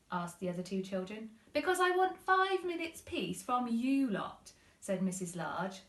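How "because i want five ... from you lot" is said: louder, higher, fed-up mother; exasperated mum. Acted. Mum telling the kids off, exasperated and louder. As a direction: lay it on like a tired mum.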